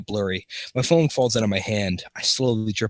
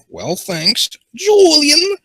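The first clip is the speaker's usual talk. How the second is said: rising to a loud, high squeal on the name; hammed-up high-pitched thank-you; playful character thanks; 7 dB louder, much slower than usual. Voices character thanking Julian.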